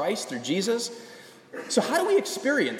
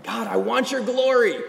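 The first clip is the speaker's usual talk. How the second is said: high, raised plea; calling out; 6 semitones higher, 4 dB louder than usual. Retold or imitated. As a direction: cry out wanting more. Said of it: "He's just calling out".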